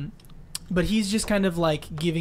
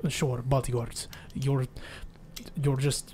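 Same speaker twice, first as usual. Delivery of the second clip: put-on low, slow Russian accent; 3 semitones lower, 6 dB quieter, slower than usual. [name] humors the self-styled bodyguards, Russian accent. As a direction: concede it grudgingly in a Russian accent. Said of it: "oh, uh, Russian".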